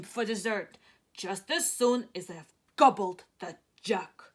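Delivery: big, loud, slowed giant rumble. Acted. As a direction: boom it out like a hungry giant.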